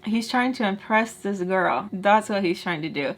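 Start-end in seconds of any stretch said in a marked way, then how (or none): none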